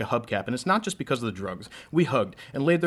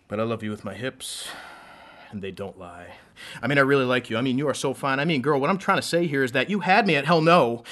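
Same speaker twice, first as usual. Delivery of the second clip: rhythmic, building slam delivery; spoken word poem; 5 dB louder than usual. Acted. Poet reciting a love poem to a crowd, spoken word. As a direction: perform a love poem on stage.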